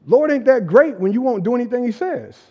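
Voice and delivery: normal talking voice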